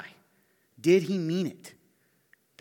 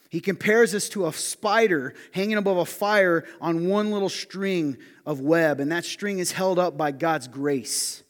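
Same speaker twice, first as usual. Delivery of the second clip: loud emphatic preaching; 4 semitones higher, 9 dB louder than usual. Acted. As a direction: drive the point home to the congregation.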